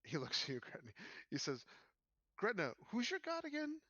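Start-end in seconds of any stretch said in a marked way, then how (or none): 2.4-3.8 pitched-up sly question; probing NPC. Acted. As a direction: fish for her god. NPC probes Gretna.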